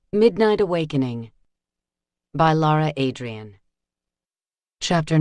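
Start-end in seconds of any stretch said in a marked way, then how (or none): none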